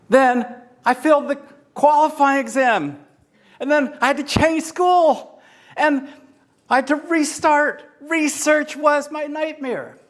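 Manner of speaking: high, anguished retelling of each setback; frustrated despair. Shown as a demonstration. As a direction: let the frustration build line by line. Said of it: "So put in that emotion".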